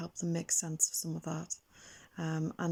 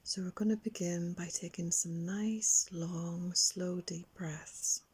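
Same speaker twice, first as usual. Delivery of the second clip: guided relaxation. Acted. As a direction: gently lead a young child into calm, lingering on key words. Leads children's guided relaxation.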